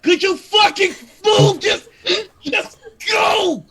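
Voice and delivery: loud, high-pitched road-rage shouting; exasperated shout from the car behind; angry honking drivers. Acted. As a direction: yell it like a fed-up driver stuck in a line. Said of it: "they're all honking like".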